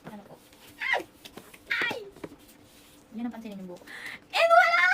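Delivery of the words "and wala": laughing shriek. Real, not acted. Laughing shout at child.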